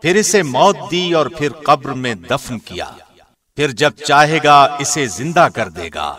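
ordinary delivery

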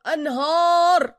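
high, very slow wail on أنهار; melodramatic collapse. Acted. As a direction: stretch أنهار like a tragic singer. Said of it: "هتعمل لي فيها هاني شاكر".

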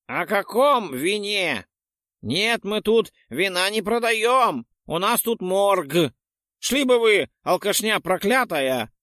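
put-on high, slow, squeaky old man voice. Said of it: "пропищал дедок".

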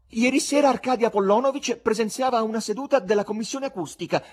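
high, loud haughty declaration. Acted. Wife answers for her husband before the theatre, very haughty. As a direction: proclaim it with wounded dignity. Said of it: "dichiarò con fare molto altero".

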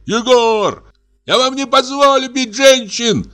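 very high, piercing enraged cry; piercing shout. Acted. Artist screaming from upstairs at the brutes, piercing rage. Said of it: "пронзительный голос художника".

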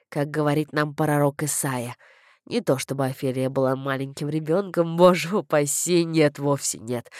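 put-on low-pitched elderly-style clergyman; chatty vicar. A narrator's reading. Vicar thanks Flavia for singing, low chatty voice. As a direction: play a rambling vicar, pitched well down.

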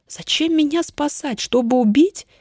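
ordinary delivery, nothing marked

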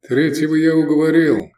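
high, forceful call. A narrator's reading. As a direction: shout it across the hall. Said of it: "крикнул голос".

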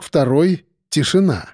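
ordinary delivery

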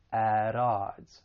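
AT ODDS linked as "ad-odds"; flap T. Shown as a demonstration. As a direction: slowly link AT into ODDS with a flap. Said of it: "pronounce it as a flap T".

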